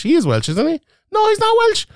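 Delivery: high, incredulous self-questioning; squeaky high bafflement; confused disbelief. Real, not acted. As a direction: argue with yourself, baffled. Confused about fighter's nationality.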